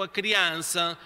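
ordinary talk

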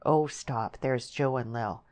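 ordinary delivery

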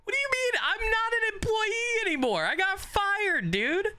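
very high, squeaky protest; mock distraught squeal. Player fired in the game, very high mock wail. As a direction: squeal it in comic disbelief.

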